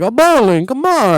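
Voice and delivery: pleading cousin begging to bowl; whiny Roman. Retold or imitated. Podcaster mimicking Roman begging to go bowling. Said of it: "and he's like".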